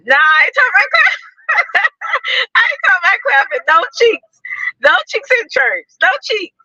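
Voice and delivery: high, laughing protest; squealing playful protest; laughing denial. Real, not acted. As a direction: laugh while you deny it.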